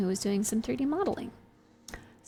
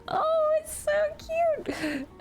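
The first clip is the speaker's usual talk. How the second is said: gushing; much slower than usual. Retold or imitated. Gushing reaction to the streamer.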